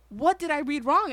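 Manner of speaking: high, loud pleading question; mock indignant confusion, voice rising; bewildered protest. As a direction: imitate a baffled child objecting. Child protesting to teacher, retold.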